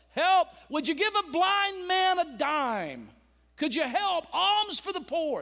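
high, loud pleading beggar's call; begging cry. Acted. Blind beggar by the road begs the crowd, loud pleading cry. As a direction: call out to passers-by for alms.

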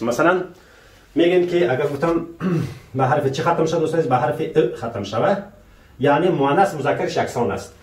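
usual speaking voice

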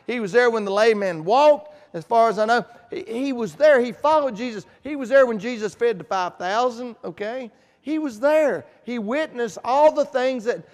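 loud, rising preacher cadence hammering each "He was there"; preaching at full force. Acted. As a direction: build it like a revival sermon. Preacher builds a repeated refrain.

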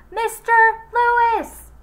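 very high, very loud yell; huge shout. Acted. Joy yelling at Louis through the door, full shout. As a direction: bellow his name. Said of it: "she shouted so loud".

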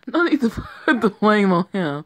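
giggling defence of a friend; laughing. Acted. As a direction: laugh while defending him.

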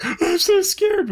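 high, slow mocking fear voice; mock scared whimper. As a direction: mimic a fake-frightened girl. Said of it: "Maggie's sitting around like".

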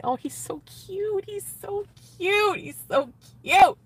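very high, drawn-out swoon; gushing squeal. Real, not acted. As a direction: slowly squeal with delight over a crush. Gushing over her date.

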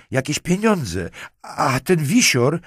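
high, loud, agitated; distraught. A narrator's reading. Marquise points at the body while confessing, distraught. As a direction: gasp out the details high and loud. Terrified witness blurts out testimony.

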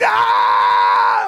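loud drawn-out "ah"; long fight yell. Retold or imitated. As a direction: let out a big movie-fight scream.

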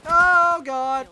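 long, high, very loud cry; alarmed scream. Real, not acted. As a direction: stretch out a panicked scream. Sudden danger in game.

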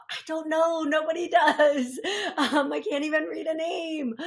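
laughing through the words. Real, not acted. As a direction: chuckle at yourself while talking. Laughing at own misreading.

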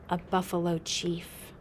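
usual speaking voice

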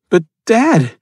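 a scared son pleading with his dad; boy's voice. Acted. Son interrupts his father, high and anxious.

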